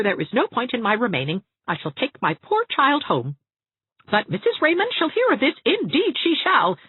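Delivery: high, haughty threat; affronted matron promising trouble; huffy lady. A narrator's reading. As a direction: sweep off with offended dignity. Mrs Trent huffing off.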